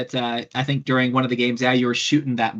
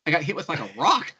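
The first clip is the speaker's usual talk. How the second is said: normal talking voice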